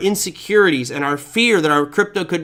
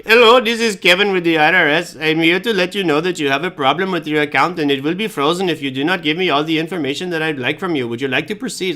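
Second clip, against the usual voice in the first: put-on phone scammer with a heavy Indian accent; thick Indian accent. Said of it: "it has the thickest Indian accent ever".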